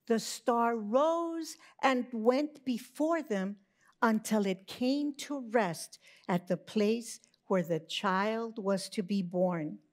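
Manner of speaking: ordinary delivery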